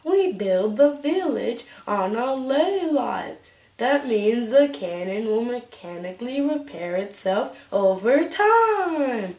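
high slow character voice; advisor voice. Acted. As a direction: announce it in a high voice. Voicing advisor lines.

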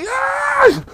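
high loud throwing yell; effort yell. Acted. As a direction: heave him out with a shout. Throwing the customer out. (grito de esforço agudo e alto)

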